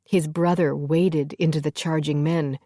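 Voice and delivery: plain reading voice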